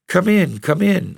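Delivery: high, eager calling in; eager invitation. A narrator's reading. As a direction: call her in, pitched high and insistent. Rosalind calls sister in.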